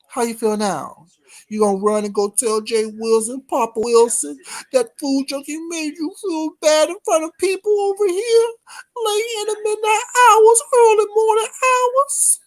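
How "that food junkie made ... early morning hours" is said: high mocking whine, voice raised much higher. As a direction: sneer at him in a high crying whine. Mocking Randy in chat.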